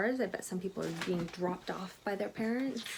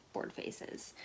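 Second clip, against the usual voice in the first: bored whisper; 9 dB quieter than usual. Shown as a demonstration. Echoes with bored face.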